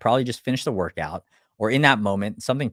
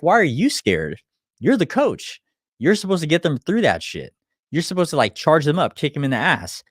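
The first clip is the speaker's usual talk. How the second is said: firm scolding; 5 semitones higher, 4 dB louder than usual. Retold or imitated. Scolding a timid coach.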